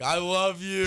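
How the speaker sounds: high, loud burst of joy with a laugh around it; joyful blurt. Retold or imitated. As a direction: blurt it out mid-laugh, pitch jumping up. Face to face in dog pile.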